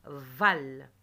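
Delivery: "Val" as one firm, loud beat; isolated syllable. Shown as a demonstration. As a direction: punch out "Val" for pupils to repeat. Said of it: "Répétez".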